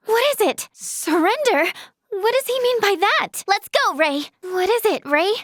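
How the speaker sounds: usual speaking voice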